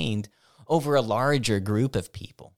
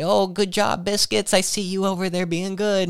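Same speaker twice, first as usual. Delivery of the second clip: high-pitched dog-praise voice; praising a dog; 10 semitones higher than usual. Shown as a demonstration. Staff member passing a shelter dog's enclosure, praising sweetly. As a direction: coo approval in a much higher, sing-song pitch.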